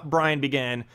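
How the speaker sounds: ordinary delivery, nothing marked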